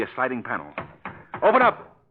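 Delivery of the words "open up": higher, louder call through a wall; loud demand. Acted. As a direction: shout at a hidden door to open. Pounding on a wall panel.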